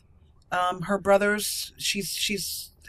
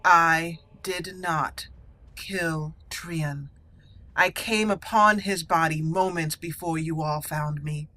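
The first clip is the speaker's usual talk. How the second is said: defiant accused noble; 3 semitones lower than usual. Acted. Condemned noble to Lord Harrowmont, defiant. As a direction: stand tall before the judges, innocent. Defends herself at sentencing.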